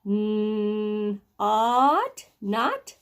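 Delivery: segmented phonics reading of "not" with short O; sounding out. Shown as a demonstration. Teacher sounds out a short O word, segmented. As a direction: hold the N, then "ought", then blend "not". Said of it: "Short O".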